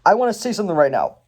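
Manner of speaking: mock-earnest insisting; Lloyd. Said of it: "Lloyd comes as close to saying".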